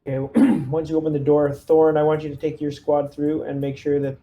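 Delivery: ordinary talk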